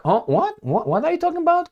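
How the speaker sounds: high, fast, mock-shocked squeal. French officials caught off guard, squealing in mock shock. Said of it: "these guys were like".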